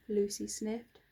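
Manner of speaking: usual speaking voice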